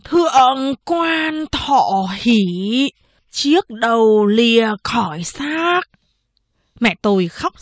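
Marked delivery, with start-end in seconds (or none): 0.0-5.8 slow, drawn-out solemn pronouncement; sudden solemn drop. A narrator's reading. Village woman solemnly reading a dead man's fate. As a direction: announce the death slowly and gravely. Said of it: "Giọng bà Cầu đột nhiên trầm hẳn xuống".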